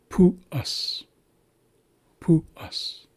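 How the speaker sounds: "pu-us" starting high, then falling after a short break. Shown as a demonstration. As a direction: start high on "pu", drop onto "us". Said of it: "we're starting on a high tone".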